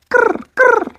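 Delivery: high croaking raven noise. Acted. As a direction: croak it out like a bird. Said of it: "made a noise like".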